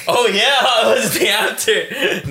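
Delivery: words through real laughter; laughing. Real, not acted. Teased about love, laughing while answering.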